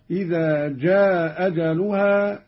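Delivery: "جاء أجلها" as "jaa ajaluha", short madd; one hamza only, "جاء" briefly held; first hamza dropped, short. Shown as a demonstration. Teacher demonstrating qasr, "جاء" short into "أجلها". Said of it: "مع المد والقصر".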